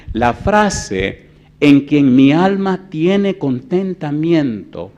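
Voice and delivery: slow, loud, deliberate preaching; emphatic preaching. Acted. Preacher explaining scripture to the church, slow and loud. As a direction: drive each phrase home slowly.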